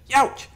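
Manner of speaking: high, loud yelp; yelp of pain. A narrator's reading. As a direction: yelp as you wake hurt. Waking in pain.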